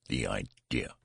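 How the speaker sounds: usual speaking voice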